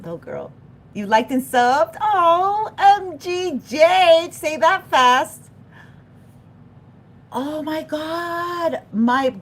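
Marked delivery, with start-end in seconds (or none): 2.0-5.5 high, loud and slow, savouring the letters; a thrilled shout at the screen; delighted surprise. Real, not acted. Greeting a viewer named MGJ, high, loud surprise. 7.3-8.8 excited gasp. Real, not acted. Excited reaction.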